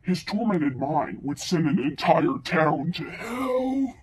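low, slow, loud horror narrator; booming demonic trailer read; deep processed voice, dragged out ominously; voice effect. Acted. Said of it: "when I do the voice effect".

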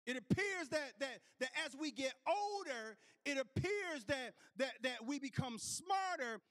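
rising sermon whoop; preaching at full force. Acted. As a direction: preach it, building the fire.

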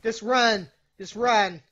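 ordinary talk, nothing marked

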